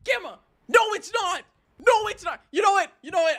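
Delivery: high, sharp fed-up protest; real high-pitched exasperation; exasperated denial. Real, not acted. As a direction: argue back in a high, worked-up voice. Exasperated quarrel with Gamma.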